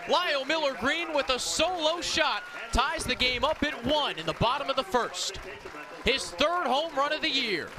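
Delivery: rising to a full shout as the ball leaves the park; booming play-by-play of a game-tying homer; excited home run call. Real, not acted. Home run call, live broadcast.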